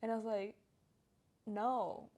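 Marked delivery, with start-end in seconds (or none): none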